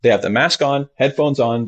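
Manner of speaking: usual speaking voice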